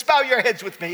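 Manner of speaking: ordinary talk, nothing marked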